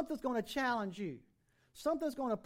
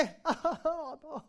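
laugh; 4 semitones higher than usual. Real, not acted. Laughs at own retelling.

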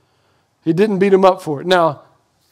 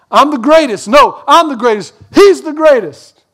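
put-on louder, higher bickering kids; mock sibling squabble, raised and high; squabbling siblings; 7 semitones higher, 6 dB louder than usual. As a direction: toss it back and forth like brothers fighting. Acted sibling quarrel over greatest.

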